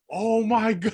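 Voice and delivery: reliving the shock with a laugh; retold shock. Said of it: "and I'm like".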